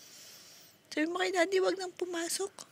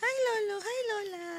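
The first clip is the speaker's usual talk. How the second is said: put-on speaking for baby; 8 semitones higher than usual. Parent coaching baby to greet grandparents, baby-talk. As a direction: coax the baby with little high hellos. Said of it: "Sabi mo".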